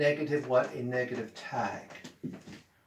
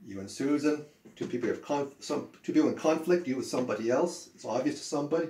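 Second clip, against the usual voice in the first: normal talking voice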